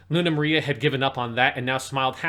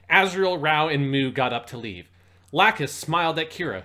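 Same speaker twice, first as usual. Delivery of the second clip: normal talking voice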